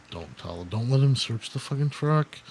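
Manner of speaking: warning a friend about the cop, hushed and urgent. Retold or imitated. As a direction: whisper the plan before the cop returns.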